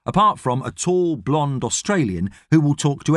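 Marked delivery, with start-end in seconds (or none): none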